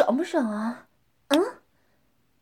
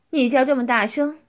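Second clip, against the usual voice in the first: ordinary talk, nothing marked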